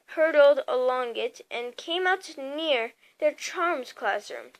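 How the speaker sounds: ordinary delivery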